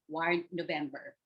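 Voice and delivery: ordinary talk, nothing marked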